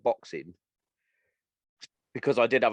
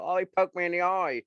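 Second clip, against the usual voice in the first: high, whiny mimic of a fighter complaining; mock whine; 8 semitones higher than usual. Mocking the opponent who flaked out, high and whiny. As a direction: take the piss out of his excuse in a high whine. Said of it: "fucking flake out and go".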